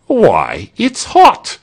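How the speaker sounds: loud yelp climbing high; alarmed surprise. A narrator's reading. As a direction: protest in startled dismay. Victim sees the boiling cauldron.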